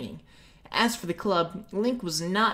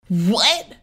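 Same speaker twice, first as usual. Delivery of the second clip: louder startled exclamation; exclaimed; 4 semitones higher, 9 dB louder than usual. Acted. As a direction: blurt it out in shock. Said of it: "Mito exclaimed".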